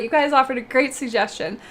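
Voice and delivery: ordinary talk, nothing marked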